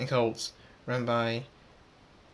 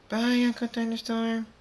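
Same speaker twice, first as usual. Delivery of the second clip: very high, held sing-out goodbye; chorus of foals; 11 semitones higher than usual. A narrator's reading. All the foals leaving Uncle Thunderstorm, high chorus. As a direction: sing it out high on one level note, like a group of kids.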